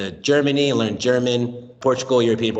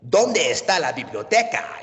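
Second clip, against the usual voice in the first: "¿Dónde está la biblioteca?" with rolling Spanish sounds, higher and theatrical; dramatic telenovela Spanish; 8 semitones higher than usual. Retold or imitated. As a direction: ham it up like a soap-opera heartthrob. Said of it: "I get super dramatic, like a telenovela".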